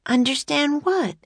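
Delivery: put-on high, puzzled young girl. A narrator's reading. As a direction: voice Annie as confused. Annie voiced by narrator.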